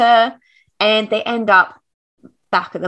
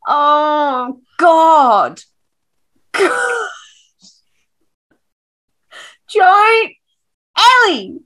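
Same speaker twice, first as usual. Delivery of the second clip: anguished wail; 8 semitones higher, 4 dB louder, much slower than usual. Real, not acted. Overwhelmed by the scene.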